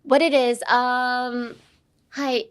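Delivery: normal talking voice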